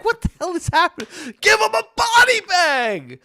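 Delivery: high, loud hammed-up yell; mock shout. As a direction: ham up the outrage, half laughing. Comic retelling of brawl.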